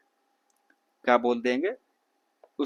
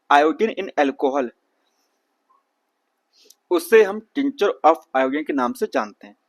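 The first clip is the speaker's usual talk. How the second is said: slow and louder, drilling the exam answer; emphatic; 6 dB louder, slower than usual. Shown as a demonstration. Teacher drills the exam answer for his students, slow and louder. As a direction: hammer home "iodine in alcohol" and "tincture of iodine" slowly for the exam.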